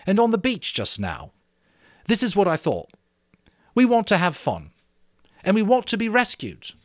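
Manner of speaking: rally the group with the plan; a boy chief sharing his plan with the assembly, eager; Ralph, eager and earnest. Acted. Ralph addresses the boys.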